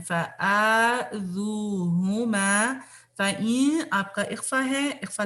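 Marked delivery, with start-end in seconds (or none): 0.0-2.7 فَآذُوهُمَا with long drawn vowels, very slow; stretched madd. Shown as a demonstration. Teacher to class: very slow stretched recitation. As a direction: draw out every madd in فَآذُوهُمَا. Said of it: "جتنے بھی مدسی ہیں".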